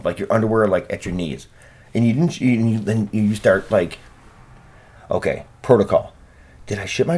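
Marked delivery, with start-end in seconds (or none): none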